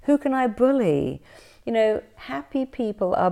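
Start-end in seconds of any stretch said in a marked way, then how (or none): 0.0-1.2 mock gleeful bully. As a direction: sneer it like a bully. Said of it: "who wakes up and goes".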